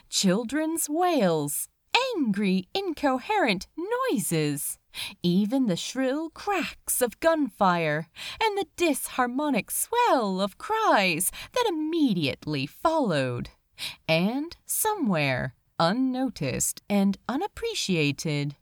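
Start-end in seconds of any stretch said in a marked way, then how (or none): none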